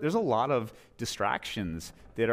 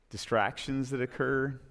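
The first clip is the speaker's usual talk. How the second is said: normal talking voice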